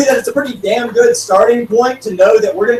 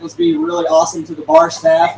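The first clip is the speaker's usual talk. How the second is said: ordinary delivery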